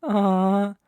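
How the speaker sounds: high, slow, taunting coo; mock sympathy. Villain taunting the stunned rival, a high, slow mock-sympathetic coo. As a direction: pretend to pity them.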